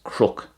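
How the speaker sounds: crook with an r replacing the n; variant pronunciation. Shown as a demonstration. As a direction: say crook, kr at the start. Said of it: "it could be pronounced crook".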